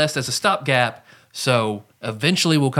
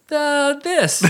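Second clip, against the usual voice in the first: very high squeaky voice ending on an upturn; squeaky character voice; 14 semitones higher than usual. Shown as a demonstration. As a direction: show off a high cartoon voice. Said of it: "what's your character voice?".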